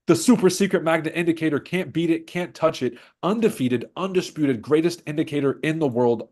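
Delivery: hype announcer. Acted. Hypes his indicator like an ad.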